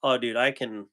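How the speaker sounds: ordinary talk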